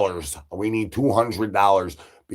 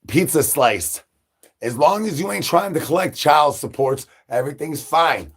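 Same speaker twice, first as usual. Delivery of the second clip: ordinary talk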